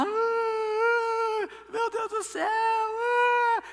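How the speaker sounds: high, loud crying wail of a paid mourner; mock wailing shout. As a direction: cry out to heaven over the dead, hammed up. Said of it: "elas gritavam, choravam".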